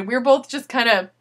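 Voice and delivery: normal talking voice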